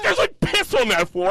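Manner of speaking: mock girlfriend voice. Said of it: "She was like".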